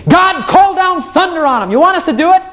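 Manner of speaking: high eager plea for thunder; high excited eager voice; zealous John. Retold or imitated. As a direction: beg for fire like a hothead disciple. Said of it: "he said".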